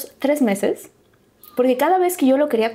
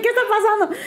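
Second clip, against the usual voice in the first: high, laughing mock bewilderment; bewildered squeal; 10 semitones higher than usual. Woman retelling her confusion at the proposal, high and laughing. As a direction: pitch it way up in baffled disbelief. Same question retold, now high.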